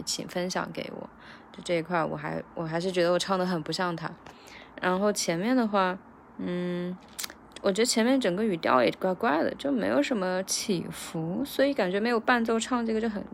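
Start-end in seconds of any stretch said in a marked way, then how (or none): none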